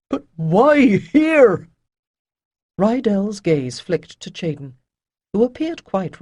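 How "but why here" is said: slow, higher, louder plea; desperate. Acted. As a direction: grasp at a last argument. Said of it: "said Avon desperately".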